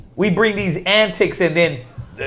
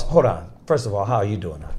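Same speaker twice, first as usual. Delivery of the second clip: stop, let it hang, then ask softly; soft, slowed greeting after a deliberate pause; dramatic pause; 10 semitones lower, 7 dB quieter, faster than usual. Retold or imitated. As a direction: Hold on, then a long held pause before the question. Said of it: "you hear the dramatic pause".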